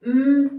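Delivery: hummed beats with the mouth closed, one per syllable; humming syllables. Shown as a demonstration. Teacher demonstrating syllable humming to students. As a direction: hum each syllable of bat with closed lips. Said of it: "इसे मुंह में बोलेंगे".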